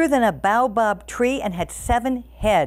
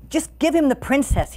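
higher-pitched storybook panic, understated; mild narrated fright, voice lifted; fearful cry; 6 semitones higher than usual. A narrator's reading. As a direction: plead like a frightened coward. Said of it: "he cried, shaking with fear".

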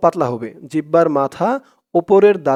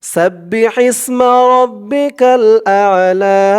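recitation; 4 semitones higher than usual. Shown as a demonstration. Teacher opening the lesson with a recited verse. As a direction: recite the verse with a chanting tune.

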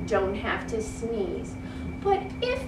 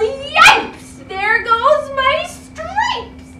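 high, loud yelp, then slow; zebra yelling; 13 semitones higher, 6 dB louder, much slower than usual. Acted. Zebra yelling as stripes fly, high loud yelp. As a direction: yelp in panic. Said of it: "The zebra yelled".

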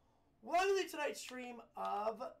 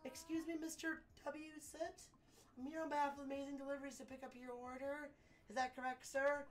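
higher, deferential courier voice; pitched-up, eager errand runner; polite young courier; 3 semitones higher than usual. Acted. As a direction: address a grand client meekly.

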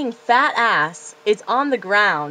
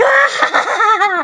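high, loud laughing shriek; crazy yell; 13 semitones higher, 8 dB louder than usual. Retold or imitated. The crazy friend with a burning blanket, laughing shriek. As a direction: shriek like a maniac. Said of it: "he's like".

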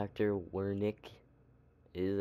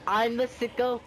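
indignant; 11 semitones higher, 5 dB louder than usual. Real, not acted. Indignant retort to inmate.

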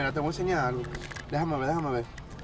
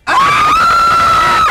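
very high, loud triumphant scream; piercing scream; 11 dB louder than usual. Acted. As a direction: scream at the top of your lungs. (alarido agudísimo de triunfo)

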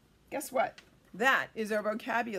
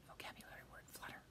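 hushed whisper, far softer; whispered aside; 20 dB quieter, much faster than usual. Real, not acted. Teacher pointing out vocabulary to children, whispered aside. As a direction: whisper it as a quick teaching note.

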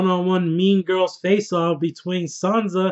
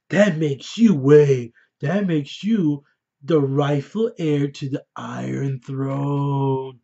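slow, lightly voiced scheming queen; mock Daenerys; 3 semitones lower, much slower than usual. Mocking Daenerys telling Jon about his claim, slow and lightly voiced. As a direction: drag it out like she only cares about the throne. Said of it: "instead she's like".

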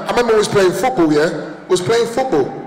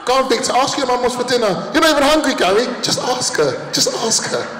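higher, nagging pleas; pushy kids; 7 semitones higher than usual. Retold or imitated. West Indian kids pushing Victor to ask, nagging. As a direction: egg him on like a cheeky kid. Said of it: "kids voiced in story".

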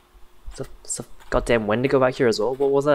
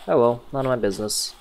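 ordinary delivery

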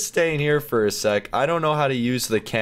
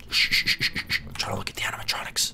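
shushing whisper; 3 semitones lower, 4 dB quieter, faster than usual. Real, not acted. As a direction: shush the room so you can watch. Shushing while watching animatronics.

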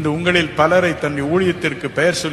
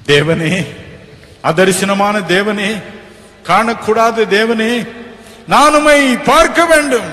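loud, pleading prayer to God; fervent prayer; 7 semitones higher, 7 dB louder than usual. Acted. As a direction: cry out to God with full force. Preacher leads fervent prayer. (உரத்த உருக்கமான ஜெபம்)

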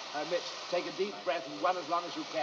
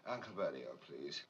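soft demo; 14 semitones lower, 11 dB quieter than usual. Shown as a demonstration. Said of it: "Now, wait a minute".

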